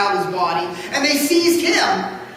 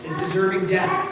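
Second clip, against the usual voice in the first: usual speaking voice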